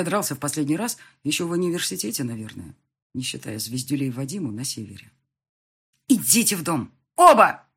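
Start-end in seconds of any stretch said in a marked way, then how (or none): 6.1-7.6 sharp high shout building to a louder last word; yelling. A narrator's reading. Woman orders both men into the house, yelling. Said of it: "Лина просто орала на нас".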